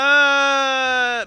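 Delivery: loud shocked cry at the screen; outraged yell. Real, not acted. Reacting to a reality show clip, a loud outraged cry. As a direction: yell out in disbelief.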